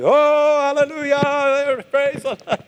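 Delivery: mock congregation erupting in praise; jubilant crowd. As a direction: shout praise as the whole church erupts. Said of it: "They're like".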